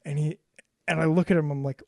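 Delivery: usual speaking voice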